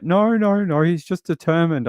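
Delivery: high-pitched retold objection; imitating hairdresser's protest. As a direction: mimic her insisting, voice pitched well up. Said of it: "and she's like".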